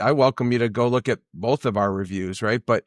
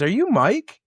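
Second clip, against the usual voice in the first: imitating the mom, pitched higher; a mom's eager higher question; curious mom; 10 semitones higher than usual. A cruise mom asking him if he's Mike, mock higher voice. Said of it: "she goes".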